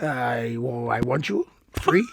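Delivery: low, soft, badly faked Portuguese accent; Mourinho. Host playing a manager wooing a player, low botched Portuguese accent. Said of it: "I can't do his accent".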